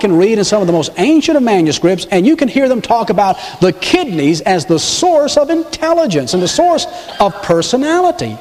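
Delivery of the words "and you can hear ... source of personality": ordinary delivery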